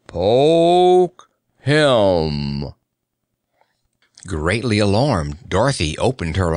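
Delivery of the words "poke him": harsh, drawn-out command; harsh, slow call. A narrator's reading. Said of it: "a harsh voice called slowly".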